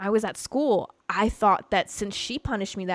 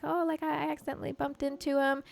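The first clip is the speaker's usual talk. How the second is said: put-on child explaining herself; 7 semitones higher than usual. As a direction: lightly play an apologetic child.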